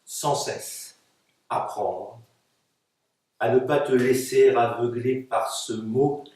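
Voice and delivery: measured, articulated recitation of a poem; poetry reading. Acted. Poet reading her text to an audience, measured recitation. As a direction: recite it line by line, with pauses. Poets read their texts aloud.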